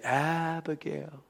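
very soft, slow and tender; calm, gentle hush. Retold or imitated. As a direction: soothe an upset teenager gently. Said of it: "my wife is so calm, she would gently".

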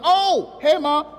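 hammed-up guilty surprise; caught off guard. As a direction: play caught out by your mother. Acting someone caught partying by mom.

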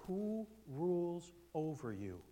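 high, slow pointed question; lightly mocked pastor challenge. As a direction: press him slowly from above. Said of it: "I've said this to many men".

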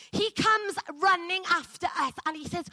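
ordinary talk

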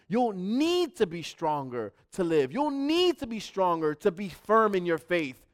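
preacher rising from calm into a loud cry; building preacher's cry. Acted. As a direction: drive the repeated line up in force. Repeated line, rising intensity.

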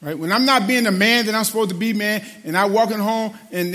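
ordinary delivery

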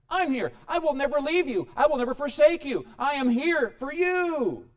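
loud, earnest reassurance; God comforting the frightened disciples; reassuring. Acted. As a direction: promise it with full conviction.

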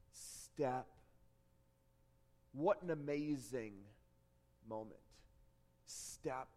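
low, soft, with long pauses; slow, suspenseful near-murmur; hushed awe. A narrator's reading. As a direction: let each word land quietly in the silence. Hushed retelling of Peter's first steps.

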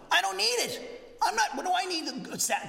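high-pitched mock protest from a skeptic; dismissive objection. Voicing a dismissive unsaved listener.

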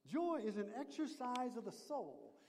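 normal talking voice

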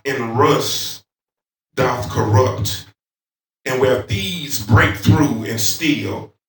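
slow, measured pulpit recitation; scripture reading. Real, not acted. Preacher reading Matthew to the congregation, slow, emphatic scripture reading. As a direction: proclaim the verse phrase by phrase, drawing it out.

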